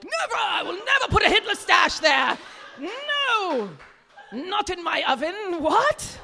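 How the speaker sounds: high, loud indignant wailing; mock outrage. Comic imitating her girlfriends refusing, mock outrage. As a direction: refuse with hammed-up horror.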